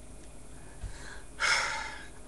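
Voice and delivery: slow exhaled sigh; long sigh. Real, not acted. Speaker settles before urging listeners to peace, sighing. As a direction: let out a long, voiceless breath.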